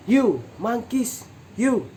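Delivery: high, pointed scolding call up to the monkeys; peddler scolding. A narrator's reading. Peddler to the monkeys in the tree, high scolding. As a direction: wag a finger with your voice. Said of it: "he said".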